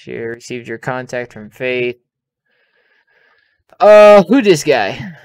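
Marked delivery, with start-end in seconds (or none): 3.8-5.1 startled, slow and loud; a sudden jolt of surprise at the screen; surprised. Real, not acted. Reacting to game message.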